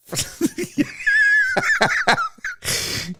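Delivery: high, wheezing laughter; real laughing fit. Real, not acted. Host cracks up over the trophy typo, real laughing fit. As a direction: crack up helplessly, too high for words.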